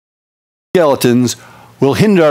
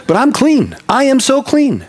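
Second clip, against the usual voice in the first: joyful relief; 10 semitones higher than usual. Real, not acted. Pastor exults, freed of guilt.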